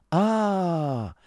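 long, high, falling cry; high-pitched knowing scorn; scornful exclamation. Acted. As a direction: draw it out high in mock reply. Acted quarrel, scornful reply.

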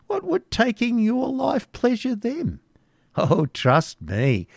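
high, cackling crone voice; cackling hag. Acted. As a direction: sneer like a witch. Hag scoffing.

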